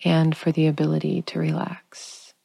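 gentle trailing whisper. Acted. Meditation winding down.

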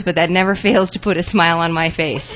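usual speaking voice

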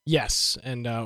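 ordinary delivery

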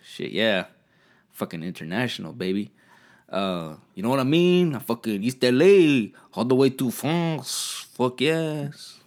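high, loud East LA brag; East LA accent. Retold or imitated. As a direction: boast like a drunk East LA homeboy. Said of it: "I'm like".

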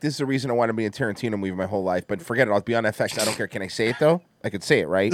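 low, fast eager rambling; overexcited babble. Acted. Host playing the over-keen actor pleading his case, overexcited. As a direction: rattle it off like someone far too keen. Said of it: "too excited about saying it".

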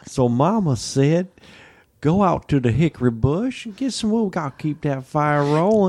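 mock Southern country voice; Southern accent. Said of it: "Let's do it in Southern".

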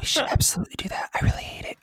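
quick whispered mock ASMR. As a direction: whisper back, teasing the ASMR style.

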